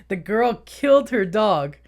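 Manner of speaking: normal talking voice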